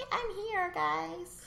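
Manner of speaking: put-on high cooing voice for the baby. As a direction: talk for the baby in a high sweet voice. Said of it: "you guys are gonna get baby voice".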